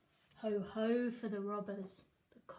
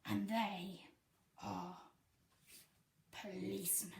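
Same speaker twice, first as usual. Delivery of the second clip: ordinary delivery, nothing marked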